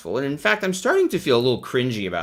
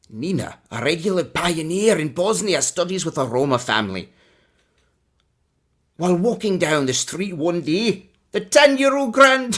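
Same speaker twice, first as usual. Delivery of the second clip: Scottish accent; 7 semitones higher than usual. Shown as a demonstration. Streamer reads the yearbook story in a bad Scottish accent. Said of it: "this is so bad".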